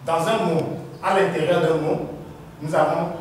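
usual speaking voice

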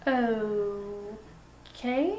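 soft, drawn-out, rising okay; awkward okay. Pretend. Host reacts to the guest walking out, awkward soft okay. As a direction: stretch it out quietly, lifting at the end. Host left alone after walkout.

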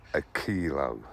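ordinary talk, nothing marked

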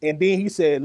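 ordinary talk

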